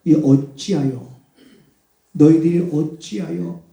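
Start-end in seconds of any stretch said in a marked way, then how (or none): none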